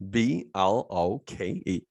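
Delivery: B L O K E spelled letter by letter, slowly; slow letter-by-letter spelling. Shown as a demonstration.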